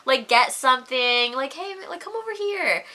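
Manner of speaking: high, casual coaxing voice; sales guy. Retold or imitated. Sales guy lures the drunk away, casually coaxing. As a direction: call him over in a lightly put-on high voice. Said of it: "the sales guy was like".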